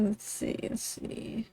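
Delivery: soft whispered muttering to herself; whispering. Real, not acted. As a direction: whisper it under your breath. Muttering while drawing.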